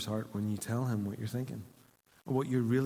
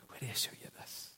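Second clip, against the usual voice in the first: very soft whisper; whispered Father voice; 13 dB quieter than usual. Retold or imitated. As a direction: whisper it intimately to him.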